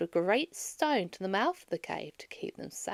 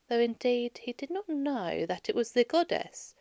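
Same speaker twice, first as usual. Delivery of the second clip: usual speaking voice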